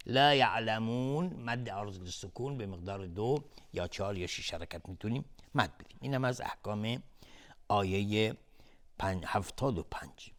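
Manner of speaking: normal talking voice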